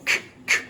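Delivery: "k" as a bare voiceless click; voiceless "k" phonics sound; k sound demo. Shown as a demonstration. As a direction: say "k, k" with just breath. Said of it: "C also says k".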